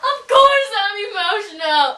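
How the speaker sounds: usual speaking voice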